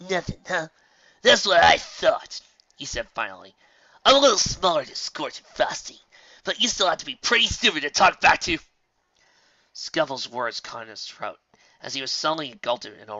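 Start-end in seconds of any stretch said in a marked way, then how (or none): 0.1-2.4 gloating. Acted. Bully gloating. 4.0-8.6 cocky bully. Acted. Bully boasting.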